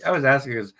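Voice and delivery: normal talking voice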